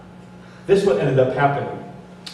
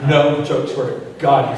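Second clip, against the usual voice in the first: ordinary talk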